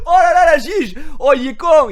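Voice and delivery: high, loud laughing outburst; laughing exclamation. Retold or imitated. The banker cracking up at the client's joke, loud and high. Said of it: "il rigole, il fait".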